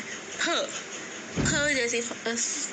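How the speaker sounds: फ as aspirated p, slow and held; sounding out फ. Shown as a demonstration. Teacher modelling फ for Sindhi learners. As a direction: blow out फ slowly as प plus हा. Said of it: "प और हा को मिलाना है".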